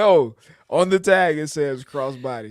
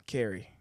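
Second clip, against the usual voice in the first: "carry" said alone after a pause, lower and softer; stress on carry; 5 semitones lower, 9 dB quieter than usual. Real, not acted. Asked why he emphasizes it, he repeats "carry" with deliberate emphasis. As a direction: set "carry" apart as a quiet, deliberate word. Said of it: "why I emphasize carry".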